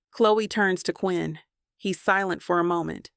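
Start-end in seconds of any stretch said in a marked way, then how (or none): none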